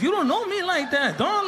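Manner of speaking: high-pitched whiny bravado; mock tough. Kid alone in his room, high mock-tough voice. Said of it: "trying to make yourself feel more tough".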